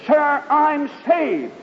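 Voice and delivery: high, slow joyful cry; joyful announcement. Retold or imitated. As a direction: tell him you're saved with glowing joy. Announcing salvation to missionary.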